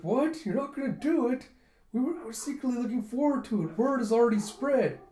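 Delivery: high, loud dismayed outburst; shocked protest. Retold or imitated. Chul protesting that the festival is cancelled. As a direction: protest in disbelief.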